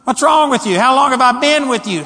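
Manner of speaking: high, loud impassioned scolding; Jesus pressing Peter, fervent and loud; exasperated rebuke. Shown as a demonstration. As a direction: plead with him to finally believe. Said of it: "It was probably more like".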